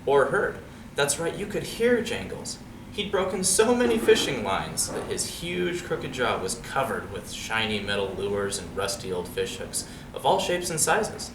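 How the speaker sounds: plain reading voice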